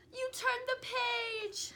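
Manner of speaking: high, slow dismayed cry; alarmed. Acted. Grover crying out at the reader turning the page. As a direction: gasp at the turned page.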